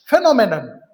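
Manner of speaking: Phenomenon said loud with a falling tune; headword called out. Shown as a demonstration. Teacher loudly announces phenomenon to students. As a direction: call out phenomenon to the class. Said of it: "teacher announces headword".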